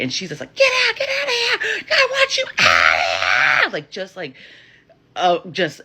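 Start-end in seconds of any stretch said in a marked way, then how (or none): 0.5-3.7 high, loud imitation of an enraged scream; hammed-up furious screeching. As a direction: shriek to drive them out, stretching the words. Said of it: "it's just screaming".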